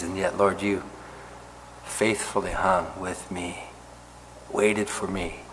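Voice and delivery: closing prayer, earnest and confessional; humble closing prayer before the group; heartfelt prayer. Acted. Preacher leads closing prayer.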